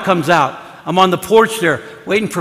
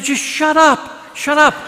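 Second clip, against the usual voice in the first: high, drawn-out retort; pitched-up sibling squabble without shouting; mock exasperated hushing; 7 semitones higher, slower than usual. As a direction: imitate a kid silencing his brother. Boy silencing his brother, retold.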